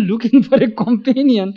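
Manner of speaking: high, loud, words shaking with laughter; laughing. Retold or imitated. Master teasing his disciple with a ghost joke, laughing. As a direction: laugh right through the retold line.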